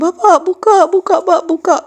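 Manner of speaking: high, loud, desperate pleading call; frightened begging. Retold or imitated. Scared child begging father to open the door, high and loud. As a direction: cry out to Dad through the door in panic.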